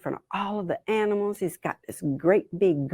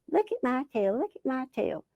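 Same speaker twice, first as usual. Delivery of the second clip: higher, softer singsong mockery; mocking mimicry; 4 semitones higher, 5 dB quieter, faster than usual. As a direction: mimic the show-off in a small high voice. Fed-up animal mimics Possum's boast.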